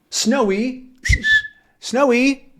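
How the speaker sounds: high calling out, rising; calling the dog. Acted. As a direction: call for the dog from afar. Tintin calling his dog.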